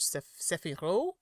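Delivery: slow, tentative French-style Sephiro; French pronunciation. Shown as a demonstration. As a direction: try it as a French speaker, syllable by syllable. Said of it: "if I were speaking French".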